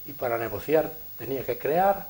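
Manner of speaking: ordinary delivery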